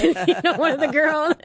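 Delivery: laughing through the words, high and loud; real laugh spilling into the words. Real, not acted. Laughing while telling the host about the girls.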